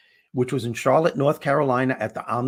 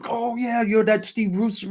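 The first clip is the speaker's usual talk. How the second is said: high-pitched imitation of a delighted fan; excited recognition; 9 semitones higher than usual. Stranger on a plane recognizes the comedian, excited imitation. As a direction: pitch it way up, mimicking sudden recognition.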